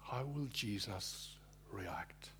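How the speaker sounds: whispered, slow and soft; hushed whisper. Acted. Preacher whispering to the congregation, hushed and slow. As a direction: breathe the question in a hush.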